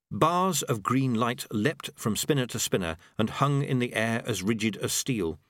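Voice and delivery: ordinary delivery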